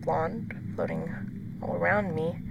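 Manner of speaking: hushed, breathy storytelling; whispered horror narration. A narrator's reading. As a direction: whisper it like a scary secret.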